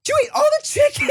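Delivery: very high, fast mock-panicked cry; panic, slight anger. Imitating wife finding the chicken gone, panicked. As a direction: squeal the accusation in exaggerated alarm. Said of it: "It was panic and slight anger".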